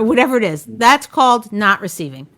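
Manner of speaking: usual speaking voice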